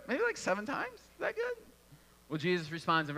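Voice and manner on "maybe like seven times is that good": very high, hesitant questioning voice; tentative suggestion. Acted. Peter throws out a number to Jesus, tentative and hopeful. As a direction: float it up high, seeking approval.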